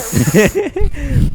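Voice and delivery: high embarrassed giggle; high laugh. Real, not acted. Embarrassed laugh after fumbling.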